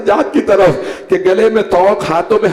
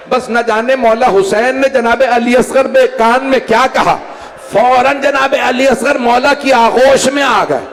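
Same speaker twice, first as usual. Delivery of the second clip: rising lament; 7 semitones higher than usual. Acted. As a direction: pitch climbing higher as the story swells. Majlis narration rising in pitch.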